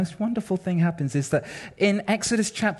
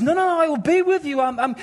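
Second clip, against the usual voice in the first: God pressing Moses, pitched way up; insistent God; 9 semitones higher than usual. Retold or imitated. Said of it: "And, and God says".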